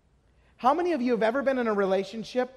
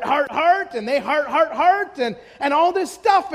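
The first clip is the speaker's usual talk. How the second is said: raised, driving sermon voice; fervent preaching; 4 semitones higher, 4 dB louder than usual. Acted. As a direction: preach it out with full force. Preaching at full force.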